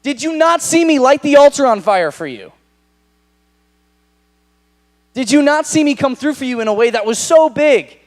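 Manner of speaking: loud, high, accusing cry at God; furious prophet shouting at God; indignant outburst. Acted. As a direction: throw it in his face, high and loud. Elijah imagined berating God.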